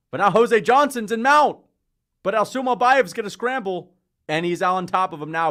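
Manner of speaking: loud, high live call; real excitement at a reversal; excited commentary. Real, not acted. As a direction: shout the scramble as it happens. Scramble in the fight.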